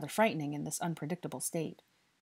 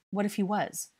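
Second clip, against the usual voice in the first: higher, falling rhetorical question; challenging warning; 5 semitones higher, 4 dB louder than usual. A narrator's reading. Harry warns the boy who confronted a stranger, challenging. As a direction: press the danger home.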